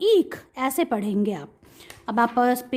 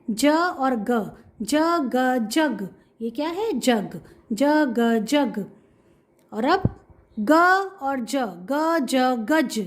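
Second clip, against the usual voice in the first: slow blending demo; slower than usual. Shown as a demonstration. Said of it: "teacher sounds out word".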